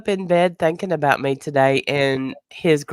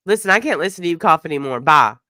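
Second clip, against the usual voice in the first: retold brush-off; faster than usual. Sick friend quoting bad friend's brush-off, quick and curt. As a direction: rattle it off, then punch the final word. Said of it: "these are the words she said".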